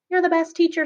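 high, sing-song sticker slogan; teacher mocking token thanks with a bright fake cheer; mock cheery praise. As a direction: chirp it like an empty compliment.

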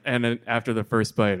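ordinary delivery

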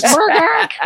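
high comic chicken squawk; chicken cluck. Pretend. Co-host joining in as the chicken's buddies, mock clucking. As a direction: imitate a clucking hen in a high voice. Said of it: "What did the chicken tell his chicken buddies?".

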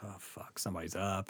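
very soft, low muttered curse; retold hushed dread. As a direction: mutter it as if not to be heard. Retold dread outside his house.

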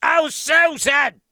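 high, loud comic cry, stretched out; hammed-up shout. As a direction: holler it like a stunned old-timer. Exclaiming after the stat.

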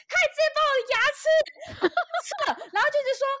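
agitated retelling. Retold or imitated. Painful dentist scene retold.

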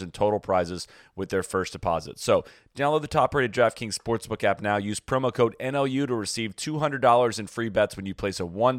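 upbeat sponsor read. Acted. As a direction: read it like a podcast ad. Ad read in podcast.

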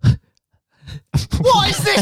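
high, slow shout through laughter; laughing outburst. Real, not acted. Host laughs in disbelief at the gun noise. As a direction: shriek it while cracking up.

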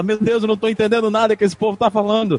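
high, exasperated complaining wife; wife's exasperated voice. Retold or imitated.

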